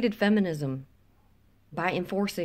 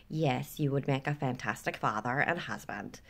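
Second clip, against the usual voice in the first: lower, badly faked Canadian accent; low-pitched mock Canadian woman's voice; attempted Canadian accent; 5 semitones lower, 5 dB quieter than usual. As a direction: try a Canadian accent and miss. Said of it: "a Canadian accent".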